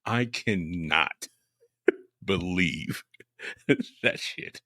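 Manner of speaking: slow words broken up by laughter; can't get it out for laughing; laughing in disbelief. Real, not acted. Cracking up over a mishap.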